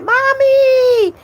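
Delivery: high, loud mock child cry of Mommy; whiny little kid. Mocking critics who expect a toddler, high babyish wail. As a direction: ham up a small child calling for mom. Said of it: "be like".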